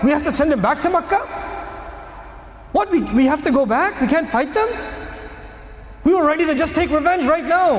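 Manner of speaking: outraged companions. Retold or imitated.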